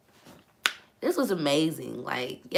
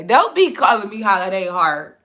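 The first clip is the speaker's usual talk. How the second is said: ordinary talk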